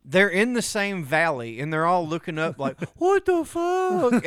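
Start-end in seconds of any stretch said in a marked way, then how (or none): 3.0-4.2 slow, high, drawn-out what the fuck; stunned dinosaurs. Retold or imitated. Dinosaurs staring up at the meteor, stunned. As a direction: gawp up at the sky in disbelief.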